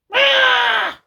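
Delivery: very high, loud shriek; shrill scream. Acted. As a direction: shriek in fright. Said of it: "She screamed".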